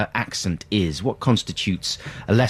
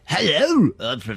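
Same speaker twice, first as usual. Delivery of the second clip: gentler, reined-in made-up Leicester voice; a lighter take on the invented Leicester accent; toned-down made-up Leicester accent; 6 semitones higher, slower than usual. Shown as a demonstration. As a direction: pull the made-up Leicester accent back a little. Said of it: "Rein it back a little bit".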